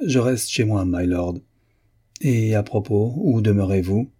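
ordinary delivery

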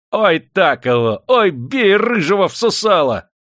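slow, high, loud whooping cheer; egging on. A narrator's reading. Master cheers the runaway in the fistfight, egging on. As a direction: whoop on the fighter with drawn-out cries. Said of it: "подзадоривал Прокофий беглого".

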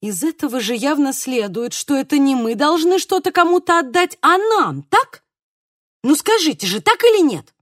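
excitement building to a high, loud demand; suddenly animated. A narrator's reading. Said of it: "вдруг оживилась Тереза". (оживлённо, с нарастающим напором)

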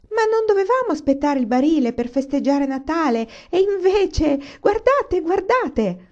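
put-on high, loud, thrilled child; excited shrieking. A narrator's reading. Carrie points out the presents, high excited shrieking. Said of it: "strillò Carrie".

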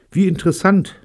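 high, loud, rattled politeness; aghast politeness. A narrator's reading. David reacting to a roomful of murderers, aghast but polite. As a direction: squeak out polite interest while stunned. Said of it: "meinte David und lächelte entgeistert".

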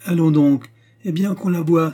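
high, loud eager command; triumphant. A narrator's reading. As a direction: call for the proof, vindicated. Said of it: "dit le roi, triomphant".

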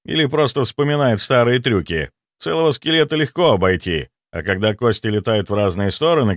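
plain reading voice

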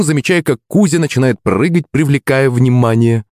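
usual speaking voice

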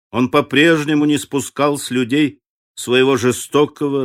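ordinary delivery, nothing marked

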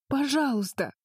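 high, cracking plea; beg, letting the voice crack; voice breaking. Acted. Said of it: "срывающимся голосом крикнула Агни".

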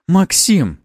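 high, loud, abrupt call; sharp exclamation. A narrator's reading. Noblewoman reprimands Maxim, sharp exclamation. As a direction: cry the name out as a reprimand. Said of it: "а вот сейчас воскликнула боярыня".

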